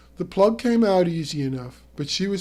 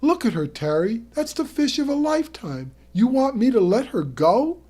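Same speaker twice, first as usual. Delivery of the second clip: pleading protest; 8 semitones higher, 4 dB louder than usual. Acted. Arguing to keep the fish.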